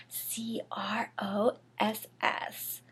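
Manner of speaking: hushed whispered spelling. Pretend. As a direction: whisper the letters like a secret. Spelling taboo word as aside.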